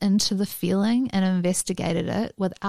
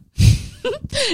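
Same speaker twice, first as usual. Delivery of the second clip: shrieking laugh, much higher; high squealing laugh; 8 dB louder than usual. Real, not acted. Laughs at own joke.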